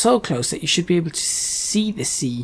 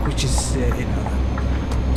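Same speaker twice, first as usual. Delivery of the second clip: ordinary delivery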